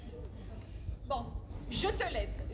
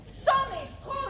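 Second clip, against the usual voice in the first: indignant; 8 semitones higher, 5 dB louder, slower than usual. Acted. Friend urges rebellion.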